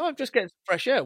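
high, fast, airy reply; mock casual innocence; breezy. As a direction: toss it off innocently. Joe acting casual.

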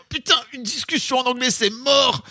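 high-pitched, imitated panicked refusal; mock panic. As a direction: mimic someone bailing out, high and amused. Imite la panique de l'ex-femme. (fausse panique, voix aiguë)

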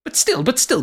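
high, rising exasperation; exasperated. Acted. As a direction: wag a finger with your voice. Character scolding.

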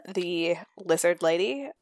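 ordinary talk

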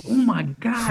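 high-pitched retold astonishment; starstruck amazement. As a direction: act out being floored by who this is. Retold recognition of a famous skier.

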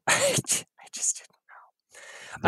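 breathy whisper with a laugh; slow, half-laughed whisper. Real, not acted. Laughing aside about not knowing.

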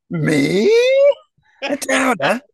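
very high, theatrical disbelief; hammed-up shocked squeal; aghast. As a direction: gasp it out, mortally offended. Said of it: "He looks very aghast when you say this".

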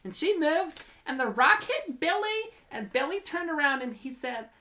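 put-on high, girlish kid-sister voice reading her diary; bratty kid sister reading her diary aloud; sister's voice. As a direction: read it as your little sister, whiny and dramatic. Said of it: "Should I do it in my, my sister's voice?".

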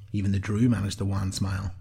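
ordinary delivery

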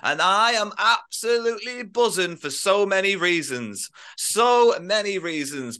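big drawn-out announcer welcome, high-pitched on the key words; hyped podcast intro. Acted. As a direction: stretch words out like a ring announcer. Hosts the podcast's show opening.

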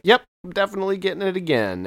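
high, quick, eager yes; eager reply. Retold or imitated. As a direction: chirp it back fast and keen. Answering the video store clerk.

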